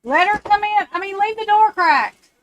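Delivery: high-pitched call across the house. Real, not acted. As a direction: call instructions to someone at a distance. Calls to someone across the house.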